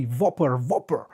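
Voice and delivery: "Whopper" said as "Vopper", the W turned into a V sound. Shown as a demonstration. Streamer showing how the Big Smart says "Whopper", with a V. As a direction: start "Whopper" with a clear V instead of W. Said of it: "it's spelled Whopper with a V".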